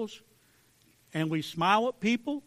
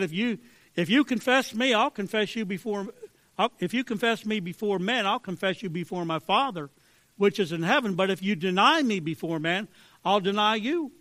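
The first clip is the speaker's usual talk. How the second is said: preaching Jesus' words; 4 semitones higher, 5 dB louder than usual. Acted. Quoting Jesus with preaching force.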